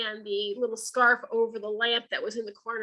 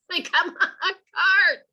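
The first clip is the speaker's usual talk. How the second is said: laughing mockery; 7 semitones higher, 4 dB louder than usual. Real, not acted. Mocking the cards, laughing.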